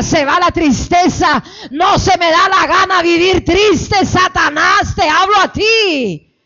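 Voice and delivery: loud, forceful command; commanding rebuke. Shown as a demonstration. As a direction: order the devil out with authority. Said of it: "Toma autoridad". (reprensión fuerte con autoridad)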